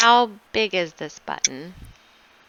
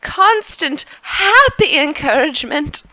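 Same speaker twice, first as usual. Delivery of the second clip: higher, louder cheerful servant; 7 semitones higher, 4 dB louder than usual. Acted. Servant Anton tells his master he is always happy, chirpy. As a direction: beam relentlessly happy.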